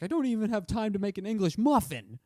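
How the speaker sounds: high, exasperated comic bit; Dane Cook. Retold or imitated. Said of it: "that Dane Cook joke where he's like".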